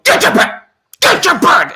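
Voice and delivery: high, breathy mock menacing mom; imitating mom's threat. Mom at the dark door with the spoon, high mock menace. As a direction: hiss it like a scary mom in the doorway. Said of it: "And she'd go".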